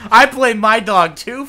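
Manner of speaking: loud, high, drawn-out excuse; playful loud declaration; emphatic mock blame. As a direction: announce it slowly and loudly, word by word.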